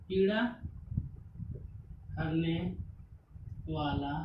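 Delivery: one word at a time, long pauses between; dictation pace. Shown as a demonstration.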